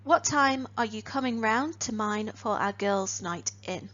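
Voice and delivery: ordinary talk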